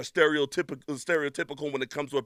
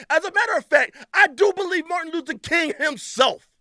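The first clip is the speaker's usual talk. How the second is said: indignant; 12 semitones higher, 5 dB louder than usual. Real, not acted. Indignant claim.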